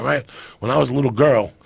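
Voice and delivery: ordinary delivery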